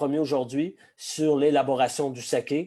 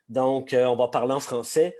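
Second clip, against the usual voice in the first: ordinary talk, nothing marked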